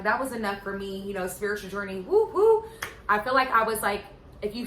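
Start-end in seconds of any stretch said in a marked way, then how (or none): 2.0-2.6 high sing-song woo; playful woo. Real, not acted. Mocking spiritual journey.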